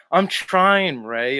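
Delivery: usual speaking voice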